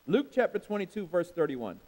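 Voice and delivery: ordinary talk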